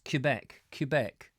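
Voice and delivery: both times "Quebec" starts like "cue"; "Quebec" repeated as kyoo-BEK. Shown as a demonstration. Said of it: "I've always called it Quebec".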